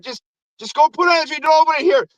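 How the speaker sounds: high-pitched, fast mock urging; urgent brush-off. Santa seller shooing the dad off, fast mock urgency. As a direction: imitate a shifty seller hurrying a buyer. Seller hurries buyer away.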